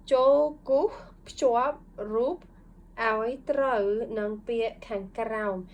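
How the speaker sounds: slow, clear Thai reading of the instruction. Shown as a demonstration. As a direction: read the Thai instruction slowly aloud. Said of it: "I'll just read it to you".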